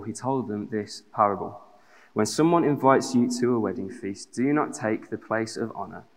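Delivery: normal talking voice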